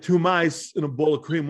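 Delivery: halting, oddly punched actor impression; put-on New York movie-star cadence; Christopher Walken. Shown as a demonstration. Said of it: "mix those movies up when I'm on stage".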